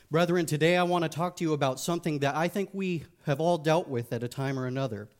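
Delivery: usual speaking voice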